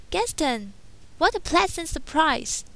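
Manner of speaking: put-on high, bright young heroine voice; Belle's voice. A narrator's reading. Belle greeting Gaston at the door, high young heroine voice. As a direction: pitch up into a polite young woman.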